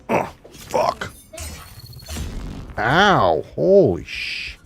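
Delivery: pained shock. Real, not acted. Hit hard in the game.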